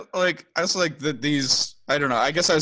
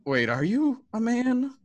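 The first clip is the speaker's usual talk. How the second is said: slow, higher, sceptical question; doubtful look voiced; 8 semitones higher, slower than usual. Podcaster voices Buffy doubting Riley, slow puzzled question. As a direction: draw it out with mock puzzlement. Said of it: "gives him a look of".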